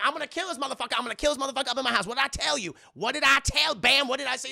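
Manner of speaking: high, loud mock rampage; Madea's furious threat. Acting Madea shooting and threatening.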